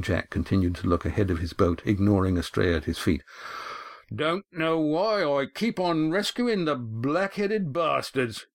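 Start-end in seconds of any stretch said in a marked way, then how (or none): 4.1-8.5 slow, higher, contemptuous seadog mutter; a disgusted captain talking to himself; grumbling old skipper. A narrator's reading. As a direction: sneer it out to the horizon.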